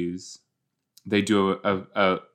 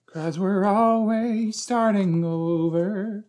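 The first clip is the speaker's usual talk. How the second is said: slow, much higher imitation of the character; drawn-out, higher mock delivery; 11 semitones higher, slower than usual. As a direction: mimic the character's line. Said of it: "that was a pretty good imitation".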